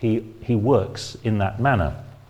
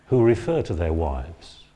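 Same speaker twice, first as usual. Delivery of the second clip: ordinary talk, nothing marked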